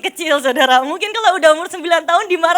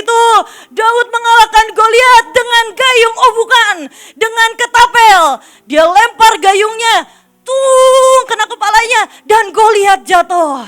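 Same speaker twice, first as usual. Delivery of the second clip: dramatic storytelling; 3 semitones higher, 8 dB louder than usual. A narrator's reading. Dramatic retelling of the fight.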